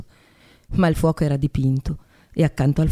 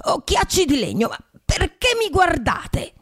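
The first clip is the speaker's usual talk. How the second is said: a touch of wounded pique; resentful; 11 semitones higher than usual. A narrator's reading. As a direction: scold the staring wooden eyes, hurt. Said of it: "disse con accento risentito".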